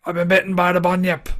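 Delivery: a bad Australian accent, half laughing. Podcaster pretending to be a Bunyip victim, mock Australian accent. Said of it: "the Australian accent is quite hard to do".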